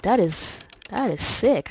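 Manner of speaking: ordinary talk, nothing marked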